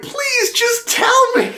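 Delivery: high, whiny begging voice; mock pleading. Recapping a film character begging, high mock plea. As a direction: squeak it out like a pathetic plea.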